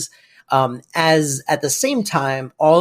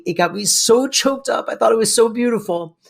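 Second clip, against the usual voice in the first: usual speaking voice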